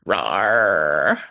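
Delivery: low cartoon rawr; a silly mock monster roar; mock growl. Mocking a man who screams at social services, cartoon growl.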